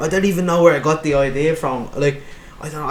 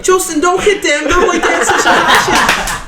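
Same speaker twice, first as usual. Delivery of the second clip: much higher, loud, slow silly posh voice through laughter; O'Shane character voice; 10 dB louder, slower than usual. Acted. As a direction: do your O'Shane, high and loud. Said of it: "snap into Oshima".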